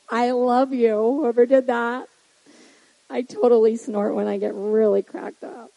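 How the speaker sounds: ordinary delivery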